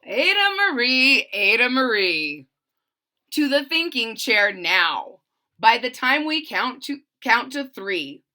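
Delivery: loud, stern scolding by full name; acted parental telling-off; frustrated parents. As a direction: order the child off like a fed-up parent. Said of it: "Her parents were frustrated, frazzled, and mad".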